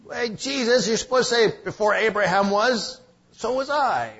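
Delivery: high, loud pedantic heckler; mock grammar correction. Preacher mocking critics nitpicking grammar, high and loud. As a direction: correct him like a fussy schoolmarm. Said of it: "'Cause his grammar was bad?".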